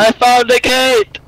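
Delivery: high, drawn-out call; excited announcement. Real, not acted. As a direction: sing out the find to your friends. Announcing a find to the group.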